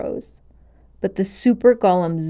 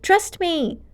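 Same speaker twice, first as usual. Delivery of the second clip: coaxing witch voice; 11 semitones higher, 4 dB louder, much slower than usual. Acted. Witch luring with slow coaxing.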